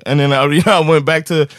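plain reading voice

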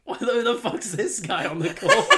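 mock annoyed question through laughter; laughing while quoting. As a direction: quote them while cracking up. Retold annoyed callers, laughing.

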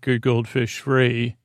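usual speaking voice

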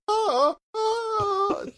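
high far-off mock snarl; hammed-up vampire warding gasps; mock vampire hiss. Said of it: "just sort of go".

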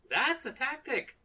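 lightly hammed-up rival's remark; amused opponent. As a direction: lift it high and stretch it, mock-appraising. Voicing Jocasta answering unseen player.

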